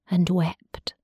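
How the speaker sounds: hushed, breathy whisper on the weeping. A narrator's reading. Narrator telling of the weeping wolf, whispered. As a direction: let it fall to a whisper.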